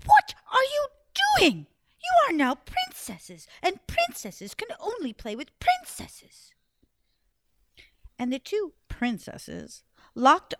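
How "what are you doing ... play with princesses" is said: high, loud screeching queen; evil queen. Acted. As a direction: screech at the girls from the stairs. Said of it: "She came screeching down the stairs".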